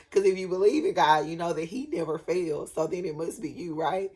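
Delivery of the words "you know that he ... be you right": ordinary talk, nothing marked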